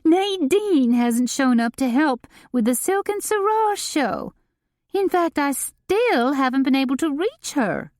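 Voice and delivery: a frantic, put-upon socialite; Delane voiced. A narrator's reading. Delane's distressed voice, read by narrator.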